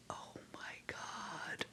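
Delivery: retold stunned gasp, whispered slowly; whispered, drawn-out disbelief; awestruck whisper. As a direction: breathe it out in a hushed, amazed whisper. Retelling her stunned first reaction.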